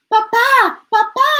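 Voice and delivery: high, loud breathless calls; boy out of breath calling his father; panting. A narrator's reading. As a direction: gasp it out after running. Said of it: "he panted".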